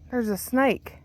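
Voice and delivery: usual speaking voice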